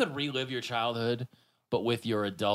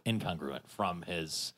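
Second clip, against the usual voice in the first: ordinary talk, nothing marked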